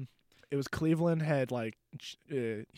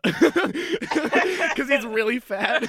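laughing; 11 semitones higher, 9 dB louder, much slower than usual. Retold or imitated. Hammed punchline, laughing.

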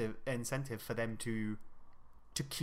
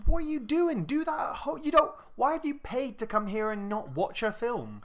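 high-pitched, incredulous scolding; indignant, pitch shooting up in disbelief; exasperated telling-off; 11 semitones higher, 4 dB louder than usual. Acted. Filmgoer berating people on their phones, exasperated.

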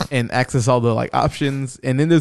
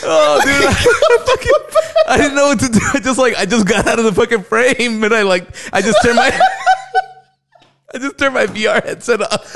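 high-pitched talk broken by real laughter; cracking up; 10 semitones higher, 3 dB louder than usual. Real, not acted. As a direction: push the story out between fits of laughing. Telling the ending while cracking up.

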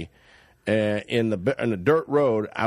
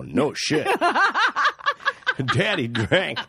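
laughing; 8 semitones higher, slower than usual. Real, not acted. Laughing at the driver's story.

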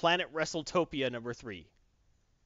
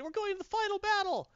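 quoted game hype. Retold or imitated. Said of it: "It's like they said".

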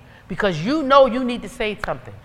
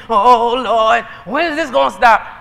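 mock whiny plea to the Lord; whining complaint; 4 dB louder than usual. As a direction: imitate a complainer wailing to God. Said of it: "Whining and complaining".

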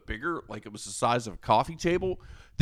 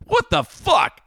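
higher, louder scolding; retold yell; 5 semitones higher, 5 dB louder than usual. Scolding Nate for not watching the guests, angry yell. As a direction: chew him out. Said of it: "I yelled at Nate".

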